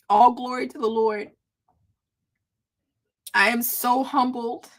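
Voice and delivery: holding back tears between long pauses; tearfully humbled. Real, not acted. Host giving God glory before viewers, tearfully humbled. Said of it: "I was not gonna cry".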